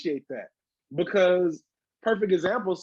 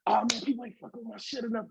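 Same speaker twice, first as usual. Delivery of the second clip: put-on childish inner artist; 5 semitones higher, 4 dB quieter, faster than usual. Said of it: "that childlike artist in me is like".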